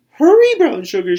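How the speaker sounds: grandmother calling. Acted. Grandmother at the doorway calling, high loud call. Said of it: "she called".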